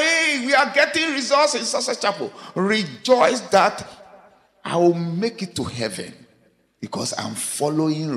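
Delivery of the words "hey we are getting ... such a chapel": high-pitched mock boast of excited churchgoers; mock rejoicing. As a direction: crow about results, imitating excited believers. Voices people rejoicing over results.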